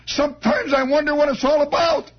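tearful drunk friend voicing despair; sobbing friend. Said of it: "he's sobbing".

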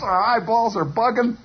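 ordinary delivery

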